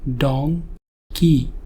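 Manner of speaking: don, pause, key; donkey in syllables. Shown as a demonstration. Teacher counting donkey's syllables for learners, split slowly. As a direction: say donkey slowly in two separate beats. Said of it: "donkey के अंदर दो syllables हैं".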